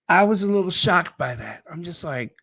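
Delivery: ordinary talk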